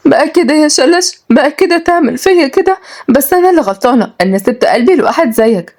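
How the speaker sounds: high-pitched, hurt woman's lament; wounded, plaintive heartbreak; tearful sorrow. Acted. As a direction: wail reproachfully, acting on the verge of tears. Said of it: "تعيط وبتتكلم بحزن".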